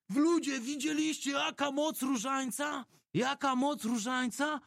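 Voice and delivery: high, slow triumphant shouting; excited shout. Retold or imitated. Future priest Amadeusz cheering the passengers, a high triumphant shout. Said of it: "Amadeusz krzyczy".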